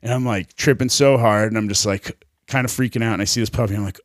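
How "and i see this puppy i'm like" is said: ordinary delivery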